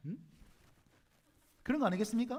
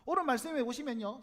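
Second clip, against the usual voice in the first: normal talking voice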